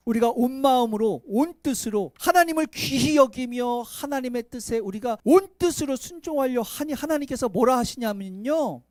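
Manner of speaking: usual speaking voice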